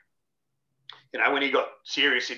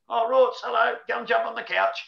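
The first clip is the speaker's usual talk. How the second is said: higher, louder mock friendly greeting; cheery wife greeting; 10 semitones higher, 5 dB louder than usual. Coach's wife greets a late visitor, higher cheery mock welcome. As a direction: sing it out like a sleepy but welcoming host. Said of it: "his wife will come in".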